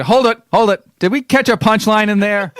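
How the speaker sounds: mock Milton Berle heckle; Milton Berle-style comic. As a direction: bark it like an old stand-up. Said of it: "Regular Milton Berle over here".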